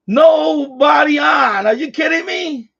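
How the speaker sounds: exasperated shout. Real, not acted. Empty channel, exasperated shout.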